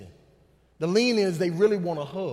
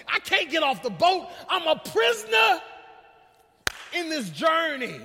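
raised, pleading cry to God; impassioned preached plea; 7 semitones higher than usual. Acted. Believer asking God about difficult people, impassioned pleading. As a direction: cry out for help at preaching pitch.

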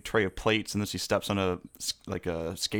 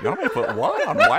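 incredulous wife; 6 dB louder than usual. Acted. Voices the confused wife.

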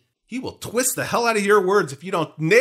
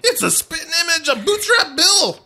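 high, squeaky pirate voice; Rigetti; 11 semitones higher, 3 dB louder than usual. Acted. Host acting out the movie minute, squeaky pirate impression. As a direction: pipe it up into a piercing pirate squawk. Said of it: "Rigetti jumps in".

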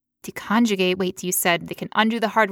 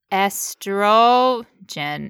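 "Estrogen" drawn out very slowly, syllable by syllable; slow spelled-out word; much slower than usual. Shown as a demonstration. A host teaches the word's parts, stretching it out slowly. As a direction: linger on every syllable of "Estrogen". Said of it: "You see?".

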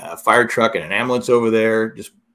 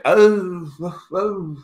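higher, laughing woozy whoas like a concussed player; imitating a concussed player, higher and laughing; dazed and woozy; 9 semitones higher, 5 dB louder, much slower than usual. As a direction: play yourself punch-drunk and amused. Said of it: "this is what you were saying".

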